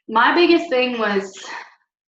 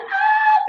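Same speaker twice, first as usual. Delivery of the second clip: high excited fangirl squeal; fangirl scream; 4 dB louder than usual. Shown as a demonstration. Vlogger showing how she screams at events: high fangirl squeal. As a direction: squeal like a fan seeing her idol. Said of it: "I scream, like".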